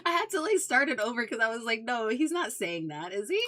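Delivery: real giggly retelling; laughing. Real, not acted. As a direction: talk through the laughter.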